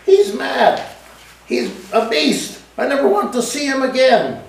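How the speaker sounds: high, indignant crying out; wife's high-pitched indignant cry; indignant outcry. A narrator's reading. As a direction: protest hotly to the unseen man. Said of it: "she cried out".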